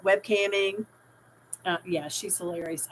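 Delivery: ordinary talk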